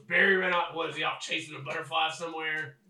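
put-on loud, exasperated old quartermaster; annoyed quartermaster. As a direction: snap it out, fed up with this soldier. Irritated quartermaster scolds soldier.

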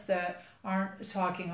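normal talking voice

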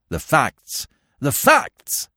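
impatient, rising to a high pitch; irritable demand. A narrator's reading. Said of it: "snapped his fingers irritably".

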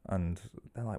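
ordinary talk, nothing marked

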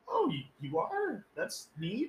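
very high, strained fake enthusiasm; mock-polite. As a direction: feign being impressed in a squeaky, hesitant voice. Retold fake-polite reply to drinker.

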